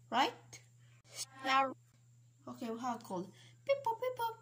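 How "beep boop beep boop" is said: phone beeps. Pretend. Dialing the doctor on phone.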